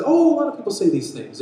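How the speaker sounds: high, loud mock hedging; breezy, high-pitched brush-off; evasive answer. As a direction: imitate followers dodging the question. Voicing disciples' evasive answer.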